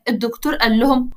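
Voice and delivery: ordinary delivery, nothing marked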